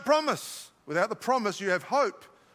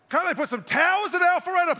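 higher-pitched exasperated plea; frustrated outburst; 6 semitones higher, 4 dB louder than usual. Real, not acted. Preacher venting about terrible phone reception, frustrated outburst. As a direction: vent frustration, pitch jumping up. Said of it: "just letting out my frustrations today".

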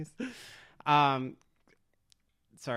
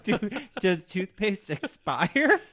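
amused disbelief; 6 semitones higher, 4 dB louder, much slower than usual. Retold or imitated. Cracking up at own question.

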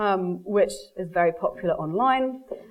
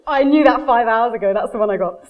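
triumphant; 2 semitones higher, 7 dB louder than usual. Retold or imitated. Triumphant punchline.

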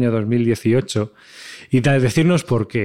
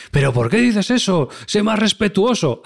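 indignant critic; 8 semitones higher than usual. Retold or imitated. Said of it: "ya te empiezan a atacar y a decir".

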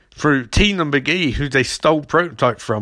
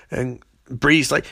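ordinary talk

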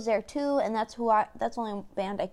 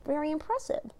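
mild ironic praise; 4 semitones higher, 5 dB quieter, slower than usual. Retold or imitated. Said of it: "so I was like".

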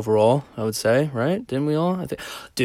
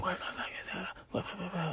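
unvoiced muffled gibberish whisper, imitating; faintly heard mother; 7 semitones higher, 19 dB quieter than usual. Man imitates neighbor heard faintly through her door, faint whisper. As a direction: mumble a barely audible mock rant. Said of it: "I can barely hear her".

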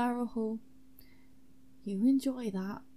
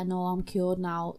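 normal talking voice

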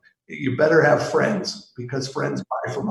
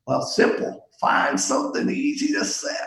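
a light put-on Callaway impression, pitched up on the punchline; mild mock Callaway voice; imitating Mister Callaway; 11 semitones higher than usual. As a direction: quote the boss dryly, like Mister Callaway would. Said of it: "I will quote Mister Callaway".

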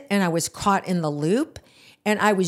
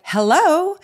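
higher, louder questioning hello; calling out; 9 semitones higher, 5 dB louder than usual. Retold or imitated. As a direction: call out to see if anyone's there. Calls into an empty shop.

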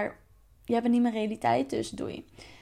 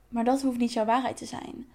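ordinary talk, nothing marked

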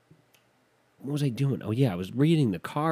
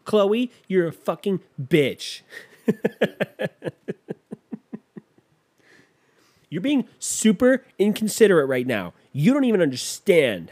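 higher, louder, snippy confrontation; hammed-up catty outburst; mock reality-show bickering; 7 semitones higher, 5 dB louder than usual. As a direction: play an offended contestant. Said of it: "They're talking into a computer like".

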